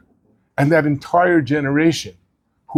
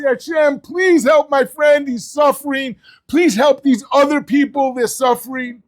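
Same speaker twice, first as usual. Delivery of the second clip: crying out to God; 8 semitones higher than usual. Retold or imitated. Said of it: "allowed to cry out and beg".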